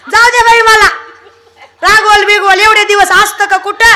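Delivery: high, laughing, teasing village women. Acted. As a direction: tease her through laughter. (हसत चिडवणाऱ्या बायका)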